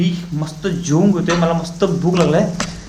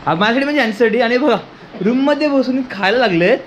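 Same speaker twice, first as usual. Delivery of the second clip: ordinary delivery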